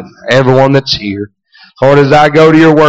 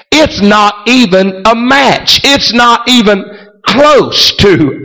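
fervent preaching; 7 semitones higher than usual. Acted. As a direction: preach it out with fire. Preaching at full force.

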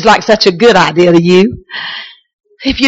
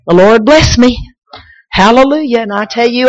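ordinary talk

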